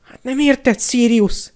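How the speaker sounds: high, loud whimper; wailing lament. A narrator's reading. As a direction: wail it pleadingly. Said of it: "siránkozott Pettigrew".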